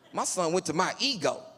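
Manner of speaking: usual speaking voice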